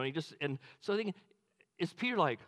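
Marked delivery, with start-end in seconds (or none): none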